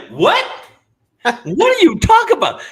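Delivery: high, loud disbelief; incredulous what. Retold or imitated. Stranger reacting in disbelief to the porn show idea, loud and high. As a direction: act out a shocked listener. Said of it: "I bet you somebody's like".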